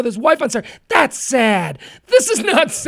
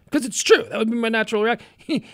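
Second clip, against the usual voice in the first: ordinary talk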